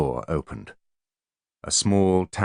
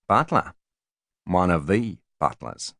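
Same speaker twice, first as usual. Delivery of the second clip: slow New Zealand drawl, a touch mocking; lightly voiced New Zealand bodyguard drawl. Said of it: "in a New Zealand drawl".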